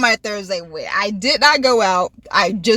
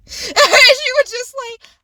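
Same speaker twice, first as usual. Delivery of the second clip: ordinary delivery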